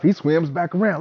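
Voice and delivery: usual speaking voice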